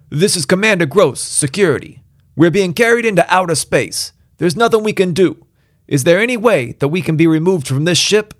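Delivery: high, loud, urgent radio call; agitated commander. Acted. Commander pleads over radio.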